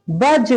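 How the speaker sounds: बज with the ज bouncing out; qalqala echo. Shown as a demonstration. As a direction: end बज with an echoing ज. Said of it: "इस तरह पढ़ते हैं इसको". (गूंजती आवाज़ में बज)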